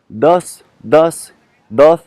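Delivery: "dos" with a tongue-tip d, close to English th; soft Spanish D. Shown as a demonstration. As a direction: repeat "dos" as a Spanish demo, word by word. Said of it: "vous allez vraiment essayer de le prononcer comme ça". (« dos » avec un d doux espagnol)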